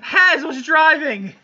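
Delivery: high, loud, drawn-out protest; protesting shout. Real, not acted. Player blamed for a kill, loud protest. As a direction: shout the blame onto someone else, slowly.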